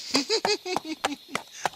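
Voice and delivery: high, giddy little cackle of glee; gleeful giggle. Acted. Ichabod gloating to his horse about the fortune, giddy high giggle. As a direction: giggle like a schemer counting his gold.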